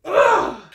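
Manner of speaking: loud, high ugh of disgust; disgusted groan. Real, not acted. A recapper groaning at Ari's choice, disgusted. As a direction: groan in real exasperation.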